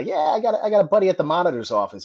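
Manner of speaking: put-on quick, high Boston accent. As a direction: imitate a Boston sergeant offering a favor. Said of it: "He had that Boston accent".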